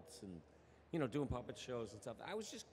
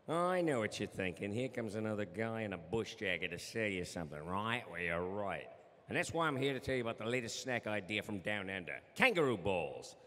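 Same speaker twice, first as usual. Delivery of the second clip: broad Australian ad pitchman, louder; Australian accent; 3 semitones lower, 3 dB louder than usual. Acted. Voice actor does a full Australian commercial accent for the panel. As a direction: sell it like an Aussie TV ad in a bush jacket. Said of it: "awesome Australian accent".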